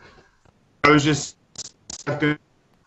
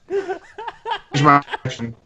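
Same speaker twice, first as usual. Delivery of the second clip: slowed, glitchy robot voice from lag; stretched-out voice on a bad connection; laggy robotic voice; 2 semitones higher, much slower than usual. Real, not acted. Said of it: "this is weird sounding".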